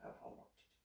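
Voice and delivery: barely voiced, muttered under the breath; mumbled reply. Son avoiding mom's questions, mumbled almost unvoiced.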